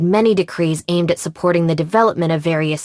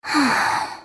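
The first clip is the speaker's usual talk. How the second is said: sigh; 7 semitones higher, 4 dB quieter than usual. Acted. Sigh alone on line.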